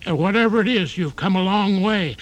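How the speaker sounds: put-on a frail old hoofer fretting in a stuck lift; elderly tap dancer. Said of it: "An elderly tap dancer trapped in an elevator".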